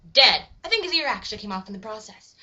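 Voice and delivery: ordinary delivery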